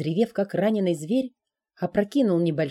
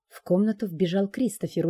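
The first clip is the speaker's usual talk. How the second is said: ordinary delivery, nothing marked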